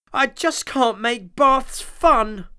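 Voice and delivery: despairing Tom. A narrator's reading.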